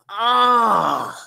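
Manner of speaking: loud, drawn-out groan, falling away; long "ah" of pain sliding down. Acted. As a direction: groan loudly after a hard landing. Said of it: "he's like".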